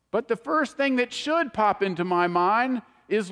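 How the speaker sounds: normal talking voice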